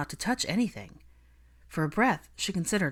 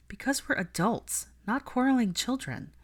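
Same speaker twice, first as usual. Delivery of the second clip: pointed reminder; 7 semitones higher than usual. A narrator's reading. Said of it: "she reminded him pointedly".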